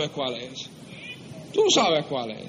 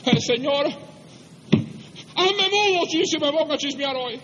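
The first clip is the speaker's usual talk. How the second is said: high-pitched, hammed-up plea; acted desperate prayer; 8 semitones higher than usual. Preacher acting a gossip begging the Lord, pleading. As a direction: beg God in a mock-desperate high voice. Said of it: "te pona solas con el Señor así".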